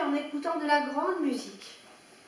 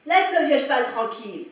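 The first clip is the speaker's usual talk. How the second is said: much louder scolding shout; angry shout; 9 dB louder than usual. A narrator's reading. Farmer scolding his dog to leave the old horse alone, loud. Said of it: "vociféra-t-il".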